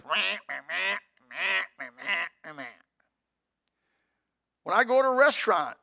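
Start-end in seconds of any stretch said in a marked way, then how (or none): 0.0-2.6 whiny meh meh; mocking blah blah. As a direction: nag in a droning meh. Imitating griping people.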